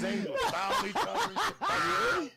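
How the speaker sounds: high, loud, slow words through laughter; laughing shout. Real, not acted. Describing speed-fuelled biker basketball, laughing shout. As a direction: let the laugh carry it up high.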